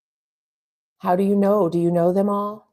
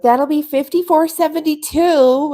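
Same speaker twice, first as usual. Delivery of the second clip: higher, brighter cashier voice; 10 semitones higher, 5 dB louder than usual. Retold or imitated. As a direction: play the checkout lady. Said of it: "the lady would be like".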